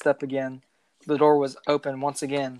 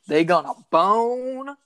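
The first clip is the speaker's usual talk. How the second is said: teasing; 8 semitones higher, 5 dB louder, slower than usual. Acted. Joking aside in story.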